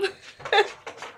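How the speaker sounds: high, airless laugh; breathy high laugh. Real, not acted. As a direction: wheeze with laughter. Laughing at the book.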